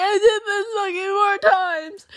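high, slow tearful wail; hammed-up sobbing, words smeared together; mock blubbering. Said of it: "fucking blubbering in the car".

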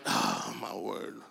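low, hushed exasperation; soft acted groan of overwhelm; exasperated sigh. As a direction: mutter it on a weary breath. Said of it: "And I'm like".

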